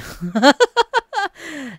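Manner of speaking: high-pitched burst of laughter; exasperated laugh. Real, not acted. Gamer who can't play it today, exasperated laughter. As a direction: laugh helplessly at the absurdity. Laughs bitterly at update delay.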